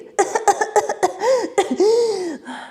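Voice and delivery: high, hammed-up choking gasps; mock choking. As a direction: act out choking on a bite. Acts out choking on meat.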